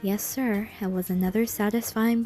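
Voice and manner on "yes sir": usual speaking voice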